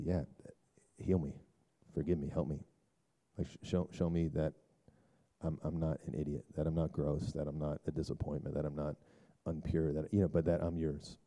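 soft, low, confessing prayer; hushed prayer. Acted. Pastor voicing a listener's plea to God, hushed prayer. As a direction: pray it quietly, laying yourself bare.

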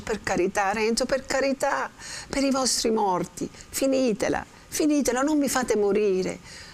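Lucia begging. Acted. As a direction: beg him to stop, voice climbing. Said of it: "Lucia dice".

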